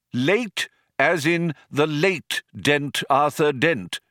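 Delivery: slow, deliberate and stern. A narrator's reading. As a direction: say it as a stern warning. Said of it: "said the old man sternly".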